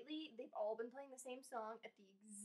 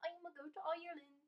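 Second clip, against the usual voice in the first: put-on Irish accent; 6 semitones higher, faster than usual. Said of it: "Irish accent?".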